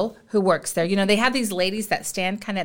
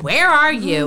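impatient call; 5 semitones higher, 5 dB louder, slower than usual. Retold or imitated. Husband's impatient text voiced.

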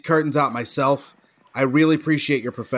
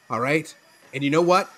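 usual speaking voice

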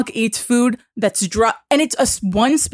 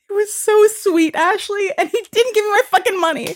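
very high, plaintive comic complaint; mock wail; 9 semitones higher than usual. Real, not acted. Telling friend he refused the refund, mock wail. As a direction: squeal it as if overcome by his sweetness.

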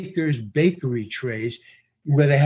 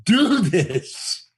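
excited; 4 semitones higher, much slower than usual. Retold or imitated. Thrilled at the job offer.

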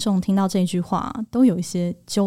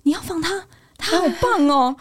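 gushing, pitched far higher; 9 semitones higher than usual. Retold or imitated. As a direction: squeal it up an octave. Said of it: "用一个高八度的音量说".

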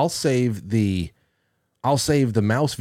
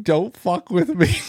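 mock screaming; 7 semitones higher, slower than usual.

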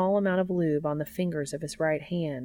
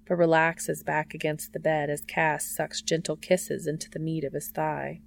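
ordinary delivery

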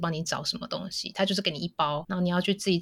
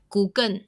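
Google 重音放在后面，尾音发 N; stress on the end. Retold or imitated. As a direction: Google with the stress pushed onto the last syllable, ending in N. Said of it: "重音又放在后面". (模仿泰式 Google，重音在后)

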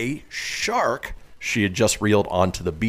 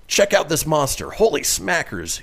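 reading the quote; 7 semitones higher than usual. Retold or imitated. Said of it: "[name] can be heard saying".

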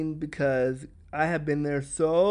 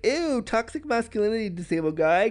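higher, mock disgusted scolding; disgusted mock outrage; 5 semitones higher than usual. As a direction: sneer at him as if in the scene, pitched up. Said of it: "And I was like".

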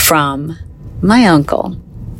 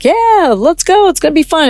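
much higher, cartoonishly cheerful; mock breezy; 11 semitones higher than usual. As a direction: parody how easy she's making it sound. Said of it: "I'm making it sound like".

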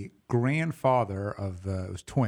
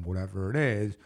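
ordinary delivery

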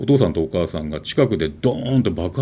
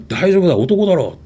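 put-on high, louder bracing call to a crying boy; soldier's encouragement; 10 semitones higher, 4 dB louder than usual. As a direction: buck him up like a soldier, firmly. 軍人が泣く子を励ます. (泣く兄弟を励ます軍人の力強い声)

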